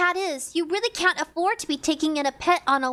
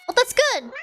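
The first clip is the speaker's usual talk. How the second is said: excited; 8 semitones higher, 4 dB louder than usual. Real, not acted. Pleased at skipping.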